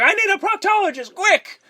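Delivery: very high, strained mock cry; urgent mock shout. Lieutenant calling for help at the crime scene, high mock-urgent shout.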